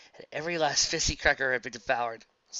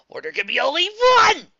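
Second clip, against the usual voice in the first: battle cry; 14 dB louder than usual. Acted.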